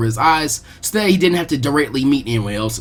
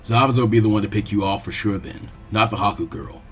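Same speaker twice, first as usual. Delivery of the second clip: low, firm adult warning; a teacher warning his student off; deep steady protective voice; firm mentor Asuma; 6 semitones lower, 3 dB quieter than usual. Acted. Asuma warns Naruto.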